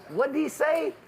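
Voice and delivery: put-on very high-pitched old lady voice; hard-of-hearing old lady. Old wife asks what cop said, very high voice. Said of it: "The old lady don't hear real good".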